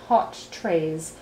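ordinary delivery, nothing marked

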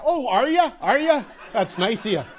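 high, loud mock gratitude; sarcastic retort. Passenger answering the captain's promise, loud sarcastic retort. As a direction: fire back with loud sarcasm.

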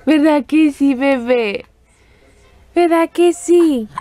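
high, stretched-out motherese. Real, not acted. Mother cooing at newborn daughter.

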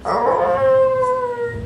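very high squeaky little growl; a tiny high "arr" like a small dog; imitated dog growl. Copying the dog's reply growl, high and squeaky. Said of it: "she goes".

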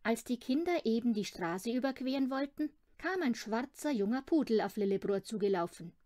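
plain reading voice

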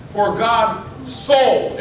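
"so" drawn out slowly; stress on SO. Shown as a demonstration. Preacher reading the verse to his congregation, stress on SO. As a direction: stretch "so" for emphasis. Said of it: "so" is important for us".

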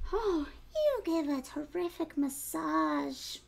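slow, contented piglet voice; blissful piglet. Acted. Piglet enjoying the fox's massage, slow and pleased. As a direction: sigh it out relaxed. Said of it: "said the piglet".

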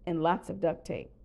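usual speaking voice

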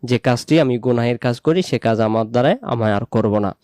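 ordinary delivery

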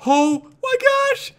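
very high, drawn-out squeal; frantic amazement. Real, not acted. Player rushing to make a diamond sword, overwhelmed.

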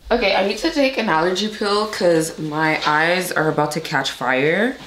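usual speaking voice

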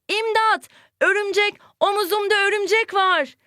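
high, frightened mock cry for help; mock fright. Shown as a demonstration. Teacher acting scared of a spider for the class, mock fright. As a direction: shriek playfully at a spider on the shoulder. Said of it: "Korku duygusu değil mi?".

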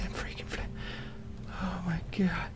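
breathless hushed whisper. Real, not acted. Gamer whispering in shock after a scare.

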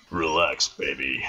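low-pitched, soft character voice; smooth flirt. Acted. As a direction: drop it low and coax them. Character line, low take.

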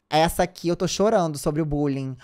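normal talking voice

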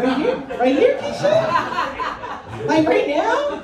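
rising high and loud disbelief; can't believe it's happening in the hallway; shocked disbelief. Retold or imitated. Replying to Keisha in the hallway, loud shocked disbelief. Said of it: "I'm like".

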